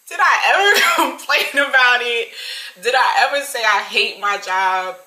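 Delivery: loud, drawn-out indignant questions; indignant retort. Retold or imitated. Worker answering the complaining customer, loudly indignant. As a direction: throw it back at her, loud and slow.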